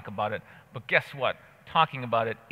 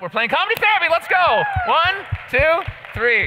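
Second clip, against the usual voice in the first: high, loud hype call; hyped announcer; 8 dB louder than usual. Real, not acted. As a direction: pump up the crowd. Hyping crowd for game.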